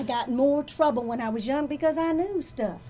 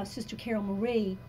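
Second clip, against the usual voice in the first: ordinary talk, nothing marked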